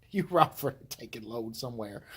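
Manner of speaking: ordinary talk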